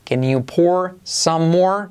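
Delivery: very slow, clear teacher model; slow model sentence. Shown as a demonstration. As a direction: lay each word out for a learner to copy. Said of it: "frase para trabajar la O más R genérica".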